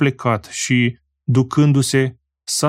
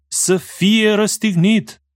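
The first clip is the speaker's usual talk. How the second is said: slow, higher-pitched insistent cry; hammed-up but restrained crowd shout; louder crowd cry; 8 semitones higher, slower than usual. A narrator's reading. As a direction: lift it over the crowd, drawn out. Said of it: "mai tare strigau".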